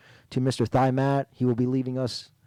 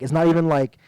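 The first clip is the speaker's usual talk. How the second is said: usual speaking voice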